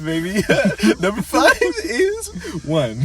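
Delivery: high, slow, laughing through the words. Real, not acted. Joking with friend about numbers, laughing hard. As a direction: crack up while saying it.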